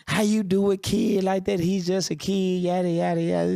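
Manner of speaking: high, sneering imitation of people complaining about a snubbed kid; mocking outraged crowd. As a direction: parody the critics' indignant whine. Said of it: "Everybody went crazy".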